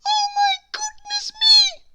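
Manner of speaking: very high, breathless whispered squeak, slow; tiny alarmed squeak. A narrator's reading. Miss Tiny startled by the pig, squeaking in alarm. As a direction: squeak it out like a frightened mouse-sized lady. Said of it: "squeaked little Miss Tiny in alarm".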